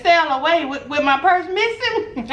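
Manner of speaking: high, slow disbelief; can't believe what she's hearing; incredulous. Retold or imitated. To the guest services lady, high incredulous protest. Staff says wait till sailing.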